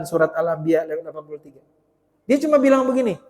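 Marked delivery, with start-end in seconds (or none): none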